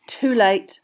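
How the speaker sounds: sour disappointment, hard first word; resentful boy giving up; bitter. A narrator's reading. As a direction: let it drop bitterly. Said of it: "said Ron bitterly".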